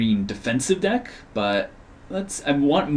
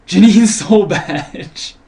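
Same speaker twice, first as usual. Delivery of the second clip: laughing; 6 semitones higher, 10 dB louder, much slower than usual. Real, not acted. Laughing at a bad card.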